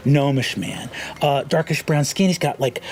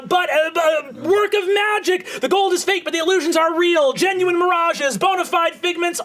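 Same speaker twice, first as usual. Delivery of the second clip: high, brisk carnival barker pitch; gnome showman; 12 semitones higher than usual. Acted. Gnome illusionist hawking to the market crowd, high showman voice. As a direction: hype the crowd like a cocky street magician.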